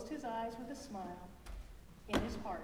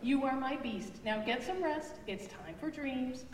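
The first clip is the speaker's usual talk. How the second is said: tender mother; 6 semitones higher, 4 dB louder than usual. Acted. Mother tucks child in.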